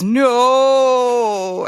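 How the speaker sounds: long, drawn-out high "no"; retold scream. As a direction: cry out one stretched no at the screen. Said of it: "I was screaming it".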